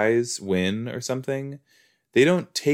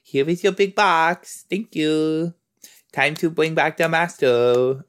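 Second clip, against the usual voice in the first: lightly voiced little kid, a bit higher; a hint of a cheerful child; 7 semitones higher than usual. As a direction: play the little kid handing over a box. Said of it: "He's just like".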